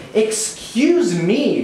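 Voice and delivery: slow, high, wailing protest; blubbering mother. Acted. Mother blubbering at the man who hit her child, slow and high. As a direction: draw out Excuse me like a sobbing outraged mom. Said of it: "the blubbering wench of a mother blubbers".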